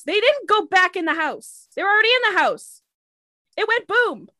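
high-pitched, frantic insistence; exasperated caller. Acted. Caller explaining the explosion to a dispatcher, frantic and exasperated. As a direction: protest as if nobody is listening.

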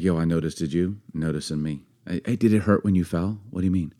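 soft, low flirty pickup line; cheesy pickup line. Pretend. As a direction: ham up a corny come-on quietly. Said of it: "romance can sometimes be pickup lines".

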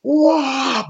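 very high wailing "whoa"; imitated wind-up cry. Wrestler winding up for his third kick, imitated cry. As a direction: mimic a fighter winding up.

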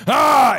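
high, full-force "Ah!"; shouted yell. Shown as a demonstration. Showing how the character shouted, high loud yell. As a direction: let out a sudden loud yell. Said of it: "when I shouted".

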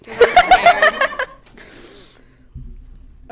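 loud, high-pitched laugh; burst of laughter. Real, not acted. Cracking up at the joke about students, loud laughter.

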